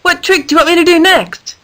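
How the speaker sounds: high, bright call across the ring. A narrator's reading. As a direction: call out eagerly to her friend. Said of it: "Danielle called out to her".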